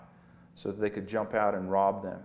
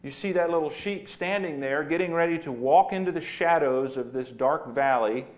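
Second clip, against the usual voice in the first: ordinary talk, nothing marked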